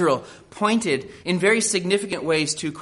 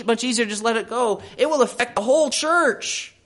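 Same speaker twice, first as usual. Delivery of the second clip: raised, urgent sermon voice; preaching at full force; 7 semitones higher than usual. Acted. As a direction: preach it hard, let it swell. Full-force preaching on sin spreading.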